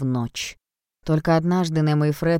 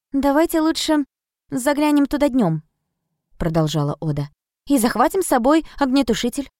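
lightly voiced sensible girl, higher; a calm, sensible young girl; 7 semitones higher than usual. Narrator voices Oda, girl.

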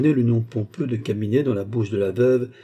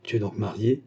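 ordinary delivery, nothing marked

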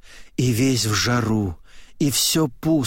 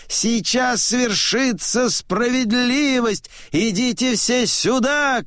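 high, ringing public call; old man crying the news; 11 semitones higher than usual. A narrator's reading. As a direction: summon everyone in a high-pitched voice. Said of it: "кричит он".

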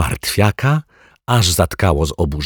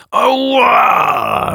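piercing high yelp, very loud; scream of pain; 5 dB louder than usual. Acted. The lodger scalded under the barrel, screaming. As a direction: yelp as if burned. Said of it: "rozległ się jego wrzask".

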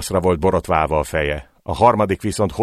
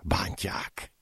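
barely voiced, much softer murmur; 12 dB quieter than usual. Painted Tongue whispering to himself while men are beaten, hushed. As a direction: breathe it out, almost to yourself. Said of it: "hümmögte".

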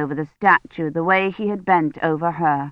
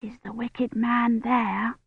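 put-on slow whispered child's question; 4 semitones higher, 7 dB quieter, slower than usual. A narrator's reading. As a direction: whisper it slowly, a little high. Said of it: "Agnes whispered".

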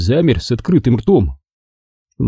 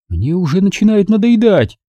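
high-pitched hurt society lady; Mrs Kane, high and offended; 9 semitones higher than usual. A narrator's reading. Mrs Kane to Engel, high and injured. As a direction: she sounds wounded that no one thanks her. Margo voiced.

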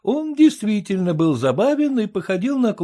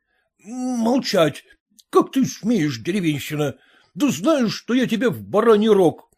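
ordinary delivery

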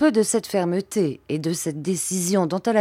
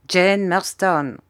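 usual speaking voice

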